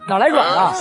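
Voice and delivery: normal talking voice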